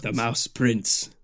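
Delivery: slow, grand title; drawn-out regal voice; mock solemn proclamation. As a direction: proclaim it like a prophecy.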